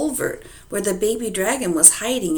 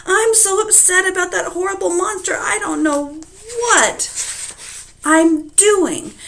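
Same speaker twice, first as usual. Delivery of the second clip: upset wife; 13 semitones higher, 5 dB louder than usual. Acted. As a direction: fret out loud like a frightened farm wife. Upset wife explains herself.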